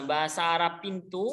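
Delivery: ordinary talk, nothing marked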